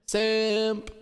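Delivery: high altered voice; voice effect. Shown as a demonstration. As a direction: say it through a voice changer. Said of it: "Number three is".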